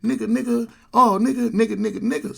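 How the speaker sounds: high, loud, run-on slang; mimicked careless repetition. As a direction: imitate someone saying it over and over. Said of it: "he just kept saying".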